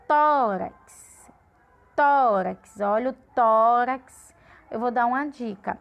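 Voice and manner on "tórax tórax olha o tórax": "Tórax" with a long, forceful "Tó"; stress on accented syllable. Shown as a demonstration. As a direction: hit the first syllable of "Tórax" and hold it. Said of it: "deixa a sílaba com som maior".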